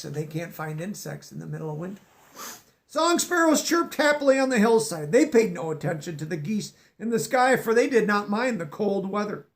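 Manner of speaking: plain reading voice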